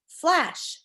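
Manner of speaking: high, sharp flash of a word; dramatic sound word. Acted. Teacher reading about lightning to kids, dramatic high pop.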